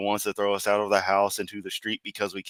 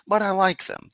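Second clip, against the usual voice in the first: cutesy high voice; 12 semitones higher, 4 dB louder than usual. Pretend. Joking about kawaii VTuber voice.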